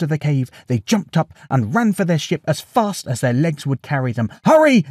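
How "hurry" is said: loud, high urgent shout; yelled. A narrator's reading. Odysseus to his men boarding the ship, yelled. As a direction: yell it to get them moving. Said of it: "yelled Odysseus".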